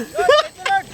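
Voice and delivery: very high, giddy laugh; excited laughter. Real, not acted. Angler thrilled with the spot, squealing laugh.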